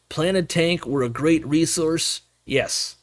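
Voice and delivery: usual speaking voice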